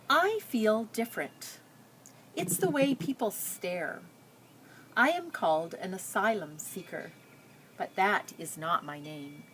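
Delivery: put-on reading a picture book aloud to children; story narration. A narrator's reading. Teacher reading a refugee girl's story to her class, storytelling. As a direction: tell it gently, like reading to a class.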